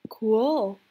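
ordinary delivery

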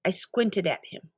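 usual speaking voice

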